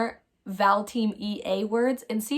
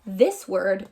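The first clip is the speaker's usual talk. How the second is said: ordinary talk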